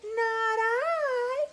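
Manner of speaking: high, drawn-out meowing whine; whiny cat. Shown as a demonstration. Storyteller showing children how a cat would say it, high whiny voice. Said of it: "very whiny".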